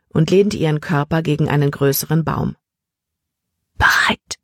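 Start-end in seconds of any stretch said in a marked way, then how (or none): 3.7-4.4 fully whispered one-word question. A narrator's reading. Libby whispers back to Emma from the tree, whispered. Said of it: "flüsterte sie zurück".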